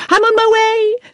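very high, eager exclamation; excited cry. A narrator's reading. Victoria interrupts the manager on the phone, excited cry. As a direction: cry out, ready to dash off. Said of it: "cried Victoria".

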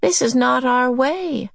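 high, regretful character voice; mildly sorrowful reproof; sad. A narrator's reading. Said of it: "Missus Whatsit said sadly".

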